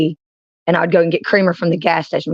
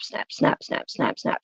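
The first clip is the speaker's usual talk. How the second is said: ankle pops whispered, much softer; whispered ankle snap; 3 semitones lower, 11 dB quieter, slower than usual. Retold or imitated. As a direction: breathe out each snap like a joint cracking. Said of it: "you hear it go".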